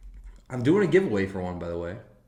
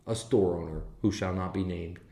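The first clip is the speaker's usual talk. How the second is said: ordinary delivery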